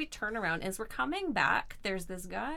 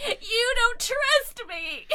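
put-on slow, high, loud drunken protest; drunk belligerent whine; 14 semitones higher, 8 dB louder, much slower than usual. Drunk teen whining at her mom, high and loud. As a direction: drag out the complaint like a sulky drunk teen. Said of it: "I get really belligerent and combative".

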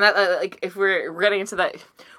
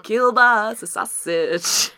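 drawn out; 2 semitones higher, much slower than usual. Pretend. Savouring the silly word.

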